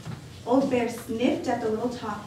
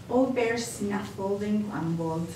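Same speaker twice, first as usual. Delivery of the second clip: ordinary delivery, nothing marked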